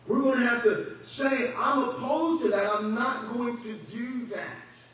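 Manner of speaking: high, loud preacher's cry; fiery sermon delivery; full-force preaching. Acted. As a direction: preach it hard to the pews. Preaching resolve.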